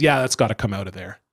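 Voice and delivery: low, quick offhand verdict; offhand doctor. Retold or imitated. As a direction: toss it off like it's nothing, low and fast. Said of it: "He goes".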